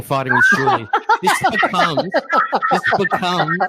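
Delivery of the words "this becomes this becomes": laughing through the words; can't stop laughing while talking. Real, not acted. Laughing at the clip.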